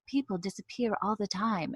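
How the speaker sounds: airy British narrator read; light British accent. Retold or imitated. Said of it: "Claire going into this whole thing".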